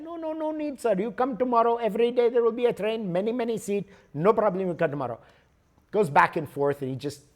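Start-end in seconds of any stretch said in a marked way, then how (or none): 0.0-5.2 higher, lightly voiced Indian clerk brushing him off; imitated ticket clerk. Ticket clerk in India waving off the traveler, higher imitated voice. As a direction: play the cheerful clerk who won't book.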